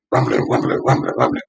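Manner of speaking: low, soft repeated murmur; muffled commotion heard from outside; imitated rumbling. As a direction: rumble the word low and quiet, over and over.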